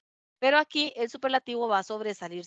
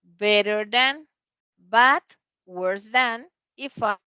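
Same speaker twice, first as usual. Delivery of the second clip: slow and a bit louder, each word clear; slow model phrase; 6 dB louder, much slower than usual. Shown as a demonstration. Teacher giving irregular comparative to students, slow and clear. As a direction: lay it out for the class.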